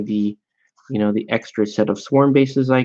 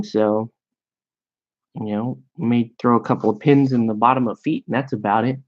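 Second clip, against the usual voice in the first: ordinary talk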